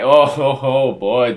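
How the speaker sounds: loud real laugh breaking through the words; burst of laughter. Real, not acted. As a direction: crack up out loud at the page. Bursts out laughing reading manga.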